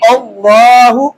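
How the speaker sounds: "अल्लाहु" begun cleanly on A, slowly; slow recited "अल्लाहु", rising at the end; fresh start after stop. Shown as a demonstration. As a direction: stretch the aa of "अल्लाहु". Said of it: "ये वक्फ का तरीका है".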